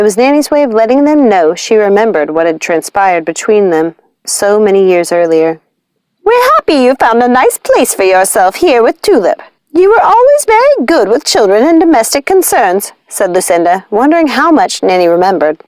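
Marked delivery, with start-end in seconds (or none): 6.2-12.9 high, honeyed witch voice with a hint of barb; sweet on top, needling underneath; sly witch sister. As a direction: Lucinda needling Nanny with sugary politeness. Lucinda speaks to Nanny.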